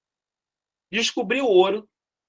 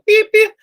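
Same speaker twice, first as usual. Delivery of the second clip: high, loud imitated two-tone beep; radio beep; 9 dB louder than usual. Teacher imitating the Nextel beep to his students, chirped high.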